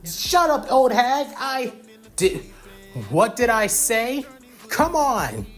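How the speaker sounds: very high-pitched heated shouting; acted high, irate yell; angry yelling. A narrator's reading. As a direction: snap back in a family quarrel. Yelling match, child and parent.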